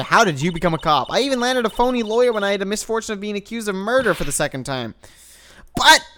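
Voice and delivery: dogged optimist cop rallying herself; Maggie voiced. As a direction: play the cheerful hapless cop, bright and loud. Reading Maggie's lines in voice.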